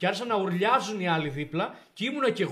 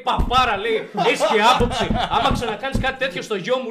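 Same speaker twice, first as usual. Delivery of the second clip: louder, higher insult, laughing through it; laughing retold yell; 5 semitones higher, 11 dB louder than usual. Retelling his father insulting the principal, loud and laughing. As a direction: hurl the insult, half laughing.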